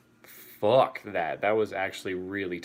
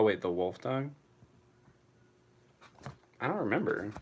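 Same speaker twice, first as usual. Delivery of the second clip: ordinary talk, nothing marked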